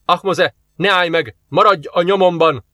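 high, urgent shout; shouted command. A narrator's reading. Jenna orders the commander to keep following her, shouted. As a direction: call out an order over the fighting. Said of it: "kiáltotta a Jenna a parancsnoknak".

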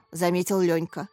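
usual speaking voice

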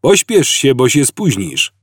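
put-on old man hurrying Arthur along; urging. A narrator's reading. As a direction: push him to move now.

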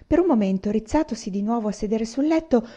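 usual speaking voice

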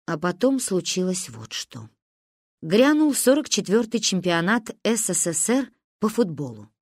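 plain reading voice

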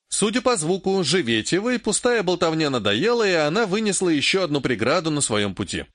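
ordinary delivery, nothing marked

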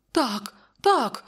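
high-pitched hasty agreement; flustered cry. A narrator's reading. Counsellor agreeing to help the wounded king, crying out. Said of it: "закричав Публій".

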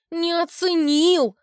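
high, loud indignant wail; high tearful outcry. Acted. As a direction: cry it out high and hurt. Albina wails indignantly.

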